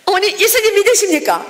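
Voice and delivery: put-on eager loud call to the old ladies; eager evangelist call. Evangelist calling to grannies.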